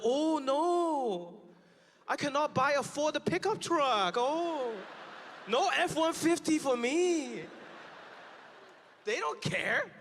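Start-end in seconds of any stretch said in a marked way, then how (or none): 0.0-7.4 slow, sarcastic fake lament; mock Chinese buyer. Chinese buyer mocks losing a Ford pickup, sarcastic. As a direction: drag out the mock sorrow. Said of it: "they're like".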